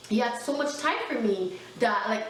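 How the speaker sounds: normal talking voice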